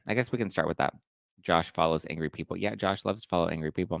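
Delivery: normal talking voice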